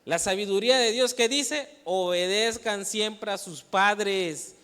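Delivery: full-force pulpit delivery; preaching. Acted. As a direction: drive the verse home to the youth.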